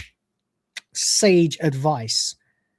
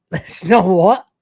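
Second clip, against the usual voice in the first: laughing disbelief; 4 dB louder, slower than usual. Real, not acted. Laughing at mumbled line.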